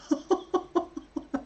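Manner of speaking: breathy high-pitched giggle. Real, not acted. Reacting to chat, a high giggle. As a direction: giggle softly, delighted.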